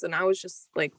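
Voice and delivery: ordinary talk, nothing marked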